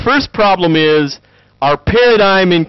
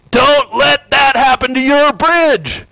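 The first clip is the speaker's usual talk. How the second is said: slow, high, grave warning to students; a lecturer's solemn caution; stern professor warning; 10 semitones higher, slower than usual. Retold or imitated. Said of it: "They go".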